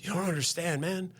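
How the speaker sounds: high-pitched, halting retelling of a crying man; mock-emotional, stammering plea; imitated tearful outpouring. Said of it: "starts hysterically crying. Like a baby".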